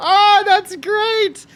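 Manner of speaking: real helpless laughter, voice squeaking high; barely getting words out through a laughing fit; crying with laughter. Real, not acted. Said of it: "I'm crying".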